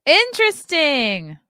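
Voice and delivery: loud, slow, high sarcastic "interesting"; sarcastic drawn-out interesting. Real, not acted. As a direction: stretch it out, mocking.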